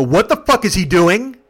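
loud, high, drawn-out disbelief; retold outrage. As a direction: act out an indignant driver. Driver confronts the two girls.

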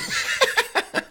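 hohes, stimmloses Kichern; Lachen. Real, not acted. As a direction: kichere luftig und hoch los. Lacht über den lustigen Namen. (echtes, hohes Kichern)

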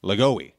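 Lagoe said "la-GO-ee"; alternate surname pronunciation. Shown as a demonstration. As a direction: say Lagoe with a GO in the middle and an "ee" tail. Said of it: "pronounces his last name".

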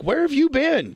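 high-pitched imitation of a mom; put-on mom voice; mom's voice. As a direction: pitch it way up as a questioning mother. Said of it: "and my mom's like".

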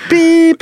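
high, sharp mouth beep; imitated beep. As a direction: say it like a phone beep.

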